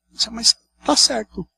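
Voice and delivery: ordinary delivery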